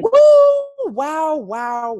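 high loud whoop; celebratory woo. Real, not acted. Celebrating twenty grand, a high loud whoop. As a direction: let out a joyful whoop.

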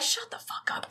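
hissed whisper under breath; whispered retort. Retold or imitated. Podcaster telling Carmen off under breath, hissed whisper. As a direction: whisper it, fed up. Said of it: "And I'm like".

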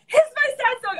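high-pitched eager imitation; mock-eager high chirp; Jennifer. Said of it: "Jennifer's like".

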